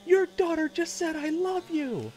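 loud falsetto excitement; reenacted high, thrilled yell; screamed big news. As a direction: shriek the news to your wife. Reenacting his screamed news to wife.

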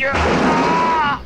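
sudden high, loud yelp; startled yelp. Acted. Balu startled while refusing Molly, sudden high yelp. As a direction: jump at a fright and cry out.

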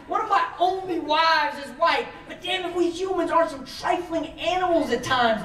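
usual speaking voice

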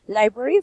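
"Library" said loud on a rising tune; model word. Shown as a demonstration. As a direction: call out "Library" like a teacher modeling it. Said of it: "teacher models the word".

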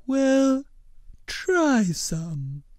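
slow, earnest blob creature voice; gentle, measured cartoon creature; Blobbert voice. A narrator's reading.

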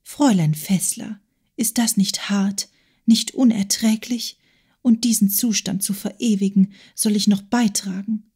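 hushed plaintive whisper; soft whispered lament. Acted. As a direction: confide the complaint under your breath. Agathe complains to Lotti.

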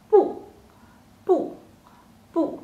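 separate, emphatic falling 不; sharp falling tone. Shown as a demonstration. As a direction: punch each 不 with a sharp fall. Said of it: "very sharp, very strong".

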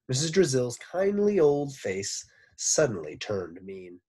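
usual speaking voice

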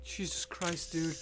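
low, very soft exclamation; shaken aftermath. Real, not acted. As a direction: say it under your breath after a scare. Recovering right after a scare.

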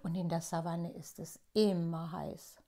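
ordinary delivery

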